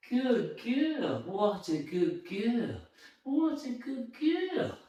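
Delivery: slow, hushed cooing; drawn-out sing-song praise; soft dog praise. Real, not acted. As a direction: coo slowly and tenderly at the dog.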